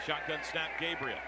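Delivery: ordinary talk, nothing marked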